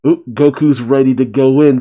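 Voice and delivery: usual speaking voice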